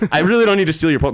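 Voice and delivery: exasperated denial. Retold or imitated. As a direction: blurt it out, fed up with the accusation. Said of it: "really aggravated".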